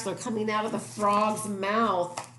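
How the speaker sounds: ordinary delivery, nothing marked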